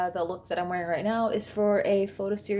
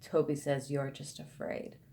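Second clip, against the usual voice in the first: put-on low, soft, ominous toddler voice; quiet, low, matter-of-fact menace; clear, serious creepy child; 4 semitones lower, 7 dB quieter than usual. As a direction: imitate an eerie child, low and deadly serious. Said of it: "so clearly and so seriously".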